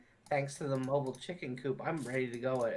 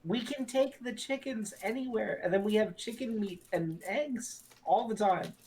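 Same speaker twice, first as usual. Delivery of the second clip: excited; 8 semitones higher than usual. Acted. [name] defends chicken coop in character.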